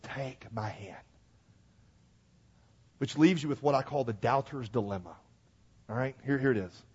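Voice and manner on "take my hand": slow, soft and tender; gentle invitation. Retold or imitated. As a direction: offer it gently and slowly. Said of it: "is he said".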